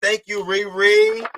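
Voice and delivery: ordinary talk, nothing marked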